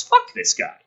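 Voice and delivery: scornful jab. Real, not acted. Ranting at a character.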